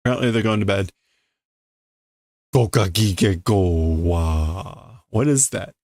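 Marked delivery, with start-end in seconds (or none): 2.5-5.0 slow, low, drawn-out mock snore; cartoon snore read aloud; snoring sound. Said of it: "Is that supposed to be snoring?".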